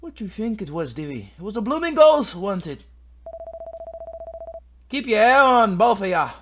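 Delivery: high, loud, bickering put-on voice; exasperated rascal. Telling both companions there are no ghosts, exasperated. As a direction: snap back at frightened companions, pitched high. Acts game characters arguing about ghost.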